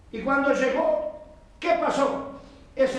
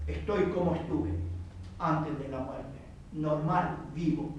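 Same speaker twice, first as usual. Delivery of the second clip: much lower, softer voice; revived boy; 7 semitones lower, 7 dB quieter than usual. Retold or imitated. Boy risen from his coffin tells the crowd, low and hushed.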